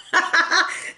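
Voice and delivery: short breathy burst of laughter. Real, not acted. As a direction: let out a quick amused chuckle. Amused by her own line.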